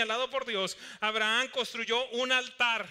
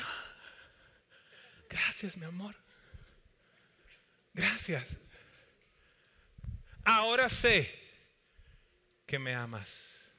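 touched thanks. Acted. Wife touched, bed finally made.

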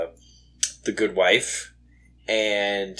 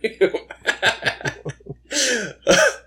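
high burst of laughter; 11 semitones higher than usual. Real, not acted. Laughing at Tag's joke.

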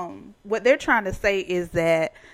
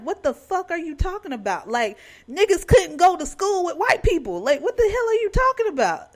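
high-pitched, indignant retort; incredulous outburst; 11 semitones higher, 4 dB louder than usual. Retold or imitated. Guest arguing back at school choice claim, incredulous outburst. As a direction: build to a fast, exasperated demand. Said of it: "I'm like".

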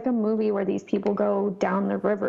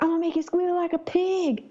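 menacing hillbilly; 8 semitones higher than usual. Retold or imitated. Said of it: "He's like".

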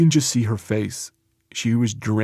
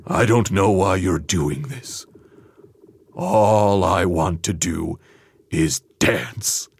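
slow, lower, innocent plea; slow dreamy patient voice; 4 semitones lower, slower than usual. Retold or imitated. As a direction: draw it out like a dazed dancer.

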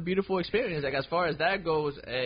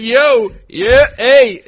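loud, raised-pitch calls for attention; retold calling out; 7 semitones higher, 16 dB louder, much slower than usual. Man retelling calls to a partner without her hearing aid, loud. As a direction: call out to someone who isn't hearing you.

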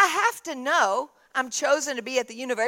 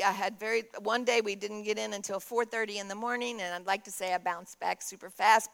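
ordinary delivery

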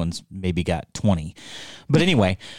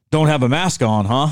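put-on local mountain man, higher pitched; 5 semitones higher, 3 dB louder than usual. Mountain man at the post office questioning a mask, needling. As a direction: challenge a stranger like a mountain man. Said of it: "Is that how he said it?".

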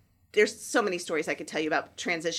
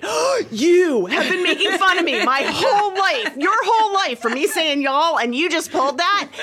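gleefully scandalized, pitched way up and breaking into laughter; mock outrage; 9 semitones higher, 7 dB louder than usual. Mom firing back at her oldest for teasing her y'all, mock-outraged.

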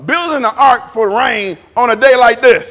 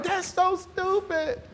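soft, slow, scornful aside; scoffing; 8 semitones higher, 11 dB quieter, slower than usual. Retold or imitated. Telling friends about the ride, quiet scoff. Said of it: "And I'm like".

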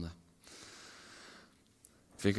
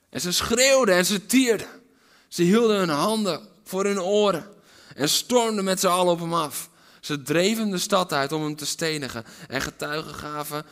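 loud, higher, intense telling; building preaching; 7 semitones higher, 10 dB louder than usual. Real, not acted. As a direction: paint the mob's fury loudly. Dramatic retelling of stoning. (luid en dramatisch vertellen)

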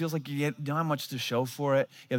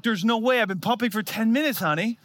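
exasperated; 7 semitones higher, 3 dB louder than usual. Retold or imitated. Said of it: "I was like".

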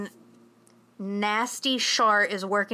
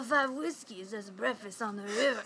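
put-on Jon Voight's movie accent; 7 dB quieter than usual. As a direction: quietly put on the actor's strange movie accent. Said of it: "that's the fucking accent he's doing".

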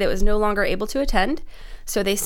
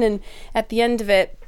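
ordinary talk

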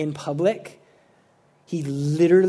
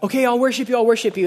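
high, louder mock pleading; mock hasty worship; 7 semitones higher, 4 dB louder, much faster than usual. Worshipers trying to save themselves, mock hasty worship. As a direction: play someone frantically offering insincere worship. Mimics insincere worshipers again.

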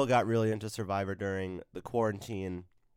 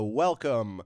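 slow, drawn-out announcer welcome; big energetic podcast opener; show host intro; 5 semitones higher, 5 dB louder, much slower than usual. Acted. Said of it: "Somehow I turned on the energy".

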